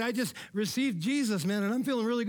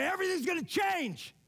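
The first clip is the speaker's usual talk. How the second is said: high, ringing yell from a rooftop; retold rooftop yell; 9 semitones higher than usual. Friend shouting to God from the roof pinnacle, retold as a high yell. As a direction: call it out high from the top of the roof. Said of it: "I yelled from the top of my".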